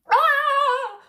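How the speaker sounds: high loud gasp; the whole crowd gasps at once. A narrator's reading. Crowd gasping as the crown flies out.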